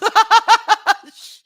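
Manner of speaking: high, loud cackle; big pealing laugh. Real, not acted. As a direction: burst out in high, knowing laughter. Laughs after listener's knowing comment.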